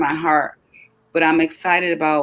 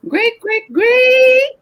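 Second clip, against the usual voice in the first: each great climbs much higher, the last one louder; rising sing-song greats; 6 dB louder, much slower than usual. Real, not acted. Host signing off viewers with climbing greats, much higher. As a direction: stretch the greats up into a gleeful squeal. Closing out the sign-off.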